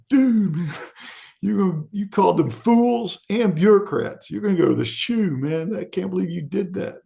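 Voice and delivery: ribbing a friend in a high jokey voice; buddies teasing. Retold or imitated. Buddies mocking him.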